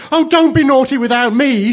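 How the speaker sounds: high, loud tearful wail; acted bawling plea; tearful cry. A narrator's reading. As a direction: wail like a little child bursting into tears. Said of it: "burst into tears".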